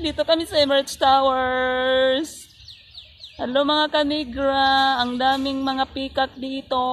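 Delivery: vlogger greeting. Real, not acted. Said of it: "vlog greeting to viewers".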